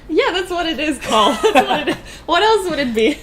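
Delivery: laughing loudly while talking. Real, not acted. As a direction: talk through a big laugh. Laughing at Paul's remark.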